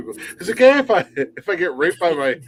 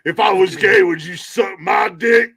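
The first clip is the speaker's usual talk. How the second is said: jeering bully; 5 semitones higher, 4 dB louder, slower than usual. Retold or imitated. Acted bully taunt, shouting.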